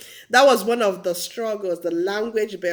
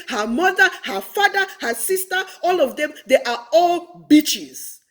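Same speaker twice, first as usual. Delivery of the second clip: mock indignation; 8 semitones higher, 4 dB louder than usual. Schoolgirl insulting a whole family, mock indignation. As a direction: rattle off each relative high, then stretch "bitches" as "beaches".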